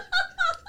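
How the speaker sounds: high squealing laugh, real. Real, not acted. Laughing hard while telling friends the story.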